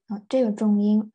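ordinary talk

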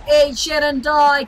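high, slow battle cry; playful game yell; shouted taunt. Pretend. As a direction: draw each word out loudly.

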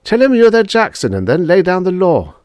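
high, loud, insistent urging; urgent prodding. A narrator's reading. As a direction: press him hard to do it. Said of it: "urged Drummond".

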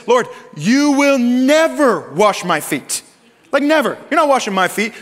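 slow, high, insistent never; emphatic refusal. Retold or imitated. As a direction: dig in and refuse each word.

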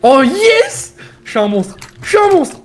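high loud victory shout; triumphant cheer. Real, not acted. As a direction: cheer like you won. Celebrating a success.

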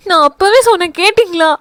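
high, keening lament; daughter-in-law lamenting to her mother-in-law, mock mourning wail. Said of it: "ஒப்பாரி வைக்க ஆரம்பித்து விட்டாள்".

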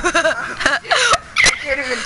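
slow words broken by laughter; laughing. Real, not acted. Teen laughing at his own awkwardness, words stretched by laughter. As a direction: laugh through the line.

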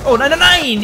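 loud, high cry of dismay; real panicked gamer yell; alarmed outcry. Real, not acted. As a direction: burst out in protest. Enemy comes as he feared.

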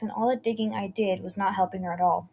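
ordinary delivery, nothing marked

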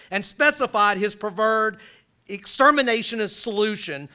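loud, high, driving delivery of grim quotations; forceful lecture reading. Acted. Lecturer thundering quotes at the class. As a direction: hammer each phrase home to the hall.

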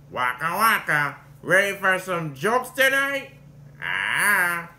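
slow, loud joking bear voice; hammed-up comedian bear, slowly; Fozzie Bear impression. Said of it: "the other one's Fozzie Bear".

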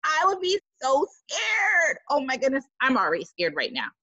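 usual speaking voice

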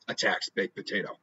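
ordinary delivery

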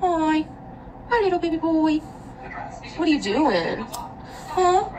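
high, soft cooing to a pet. Real, not acted. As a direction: coo gently at the little dog. Softly greeting the dog.

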